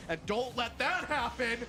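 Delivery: rising high and loud into the goal; excited goal call. Real, not acted.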